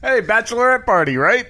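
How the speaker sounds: high, hailing stage call; crowd-work comic. Acted. Comic hailing a bachelorette party, high showy call. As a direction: call out a table like a club comedian. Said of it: "it's Broadway comedy".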